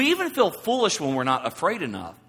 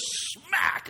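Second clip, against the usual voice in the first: imitated slap sound; 14 semitones higher than usual. Imitated slap in a movie scene.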